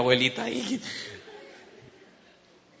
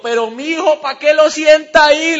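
high, loud imitated grandma; hammed-up granny's shrill reproach; scolding grandmother; 14 semitones higher, 16 dB louder than usual. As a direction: scold in a raised, high-pitched voice. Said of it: "mi abuelita le dice a mi abuelito".

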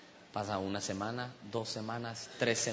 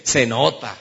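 punchy, loud echo of an answer; emphatic quoted reply; louder repeat; 2 semitones lower, 9 dB louder, slower than usual. Retold or imitated. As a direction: repeat it loudly for the whole room.

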